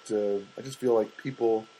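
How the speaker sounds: usual speaking voice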